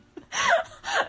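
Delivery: high shrieking laugh; burst of laughter. Real, not acted. Friend cracking up at the reading, shrieking laugh. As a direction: cracks up hard. Reacting to the card line.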